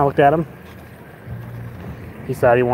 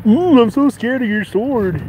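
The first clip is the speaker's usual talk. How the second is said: high, slow sarcastic taunt; imitating a drunk man's sneering taunt; mocking; 9 semitones higher, 4 dB louder, slower than usual. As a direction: drag out the mock fear. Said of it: "He was all like".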